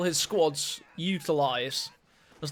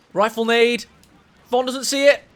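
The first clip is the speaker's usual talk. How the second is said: louder and higher, sudden alarm; excited call; 6 semitones higher, 10 dB louder than usual. Real, not acted. Caster warning that the rifle grenade is coming.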